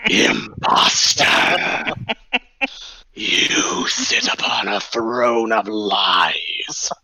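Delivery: slow, breathy, solemn denouncing; slow grand accusation. Acted. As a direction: pronounce each word like a judge. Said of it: "stands to this guy and says".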